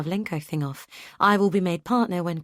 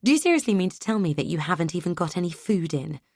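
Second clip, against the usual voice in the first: ordinary delivery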